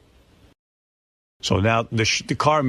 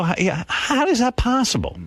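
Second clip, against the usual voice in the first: exasperated; 11 semitones higher than usual. Retold or imitated. Retelling outburst at Ronnie.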